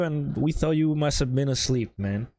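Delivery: usual speaking voice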